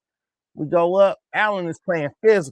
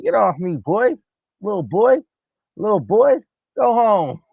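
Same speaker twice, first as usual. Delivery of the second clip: higher, louder swooping taunts; belittling mock taunt; 4 semitones higher, 4 dB louder than usual. Voicing players shaking off a little boy, mocking taunt. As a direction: talk down to him like a small kid.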